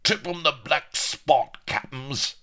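high, loud roaring cheer; rowdy huzzah from the crew; pirate roar. A narrator's reading. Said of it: "while the pirates roared".

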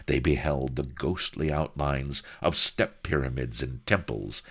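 plain reading voice

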